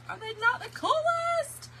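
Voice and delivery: high, delighted gush. Real, not acted. Crafter shows viewers her pages, high delighted gush. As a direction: show them off with delight.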